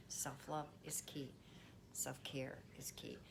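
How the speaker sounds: soft whispered "Self-care is key". Shown as a demonstration. Closing reminder, softly.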